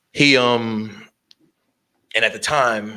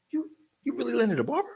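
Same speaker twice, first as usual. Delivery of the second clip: imitating a surprised guy, pitched high and fast; mock him leaning in, high and quick; imitated high incredulous question; surprised whisper-question; much faster than usual. Gang cop whispering, surprised.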